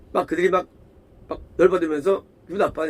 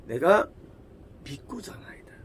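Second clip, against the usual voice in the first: whispered, barely audible; humble whisper; 3 semitones lower, 8 dB quieter than usual. Retold or imitated. Preacher contrasting the healed man's reply, whispered humbly. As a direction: say it in hushed reverence. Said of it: "다르죠?".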